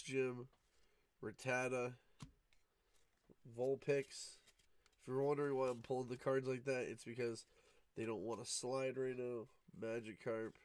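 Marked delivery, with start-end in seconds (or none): none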